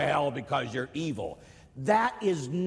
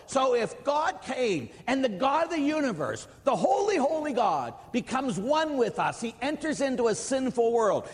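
raised, fervent sermon voice; preaching at full force; 7 semitones higher than usual. Acted. Priest preaching to his listeners, full fervor. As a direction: preach it high and strong.

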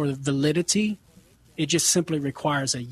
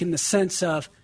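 ordinary talk, nothing marked

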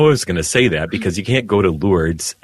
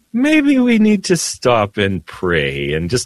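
much higher, slow put-on voice for the gentle guide; imitating the tour guide; 14 semitones higher, slower than usual. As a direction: coax the crying priest to stop and pray. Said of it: "He goes".

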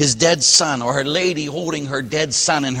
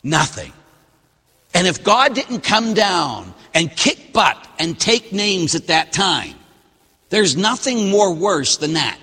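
raised, slow preaching voice; 4 semitones higher, slower than usual. Acted. Preacher to the congregation, raised and slow. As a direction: drive it home from the pulpit.

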